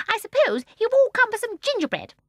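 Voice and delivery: put-on high, loud, gleeful old woman; gleeful old lady. A narrator's reading. Mrs Corrie answering Michael, high gleeful old woman. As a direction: chirp it with glee.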